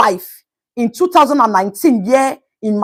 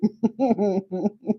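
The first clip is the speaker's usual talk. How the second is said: soft laugh; 5 semitones lower, 12 dB quieter than usual. Real, not acted. Laughing at own joke.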